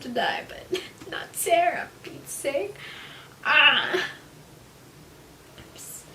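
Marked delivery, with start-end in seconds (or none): 3.4-4.0 loud startled yelp; short loud shocked shout; sudden yelp. Real, not acted. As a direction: burst out with a sharp cry. Sudden outburst while reading.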